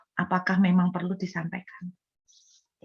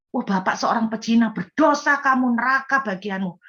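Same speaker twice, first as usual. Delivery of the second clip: raised, forceful accusing outburst; loveless condemnation; 3 semitones higher, 4 dB louder than usual. Shown as a demonstration. Preacher shows how not to tell an adulterer, harsh and loveless. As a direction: hurl judgment at the sinner. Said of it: "Yang satu nggak ada kasih".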